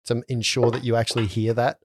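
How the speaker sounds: usual speaking voice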